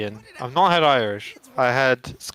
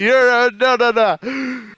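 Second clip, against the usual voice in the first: higher, louder, slow "no, no, no"; mock stubborn; 10 semitones higher, 6 dB louder, much slower than usual. Real, not acted. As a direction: draw out the refusal playfully. Refusing apology, mock stubborn.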